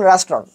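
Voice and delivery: "রেস্টুরেন্ট" as two syllables, ras-tron; "রেস্টুরেন্ট" shortened to "rastron"; correct pronunciation. Shown as a demonstration. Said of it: "pronunciation".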